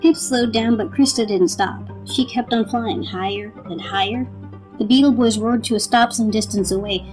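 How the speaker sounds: ordinary delivery, nothing marked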